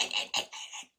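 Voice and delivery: rapid repeated whispered name; acted hushed calling; urgent whisper. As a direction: whisper to get his attention unnoticed. Secretary trying to get Rooney's attention.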